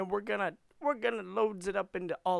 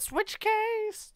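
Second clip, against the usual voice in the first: very high, loud, drawn out; loud falsetto call; high squawked catchphrase; 8 dB louder than usual. Pretend. Sing-song repeated catchphrase, high take.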